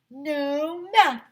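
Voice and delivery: high, loud no math; happy shout; gleeful kids. Acted. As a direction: cheer it. Boys cheering.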